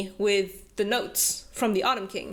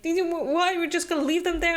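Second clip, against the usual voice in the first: retold disbelief; 7 semitones higher, much faster than usual. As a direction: replay his stunned why, pitched up. Hunt protesting, retold quote.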